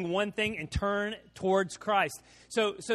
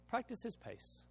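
soft, higher echo of the phrase; quiet repeat; 4 semitones higher, 14 dB quieter than usual. Shown as a demonstration. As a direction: drop the voice and repeat it gently.

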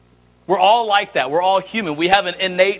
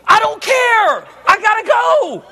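high, slow, louder mock impatience; fed-up dad; 12 semitones higher, 3 dB louder, slower than usual. Dad telling son they must leave, mock impatient. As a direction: drag it out like a rushed parent. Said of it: "I said".

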